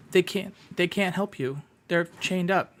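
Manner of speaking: a scared creature looking up at you; worried kobold. Acted. Meepo warns the player.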